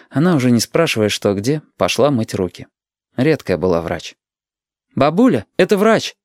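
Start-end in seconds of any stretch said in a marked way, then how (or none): 4.9-6.1 high, mildly raised call; boy's announcing call, pitched up. A narrator's reading. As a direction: call to granny in the next room, pitch rising. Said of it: "крикнул Юрик".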